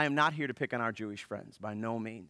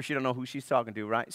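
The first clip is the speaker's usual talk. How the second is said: normal talking voice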